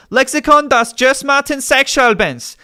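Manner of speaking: high, loud German book title; punched out, high and exasperated; exasperated shout. Real, not acted. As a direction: shout the foreign title at a heckler.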